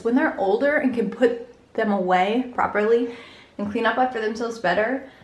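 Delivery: usual speaking voice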